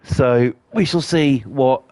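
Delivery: ordinary talk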